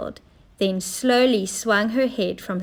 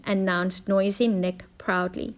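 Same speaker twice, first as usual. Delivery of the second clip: ordinary delivery, nothing marked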